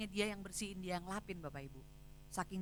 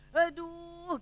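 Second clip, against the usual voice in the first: exasperated aduh; 8 semitones higher, 8 dB louder than usual. Retold or imitated. Recalls her anger.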